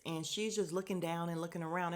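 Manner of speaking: normal talking voice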